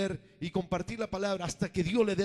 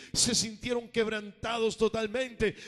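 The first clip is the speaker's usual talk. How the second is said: ordinary talk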